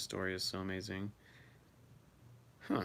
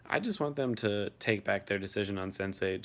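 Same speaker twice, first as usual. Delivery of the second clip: ordinary delivery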